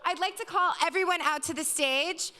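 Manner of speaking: host winding up the evening for the audience; event host address. Real, not acted. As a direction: thank the crowd and wrap up the show.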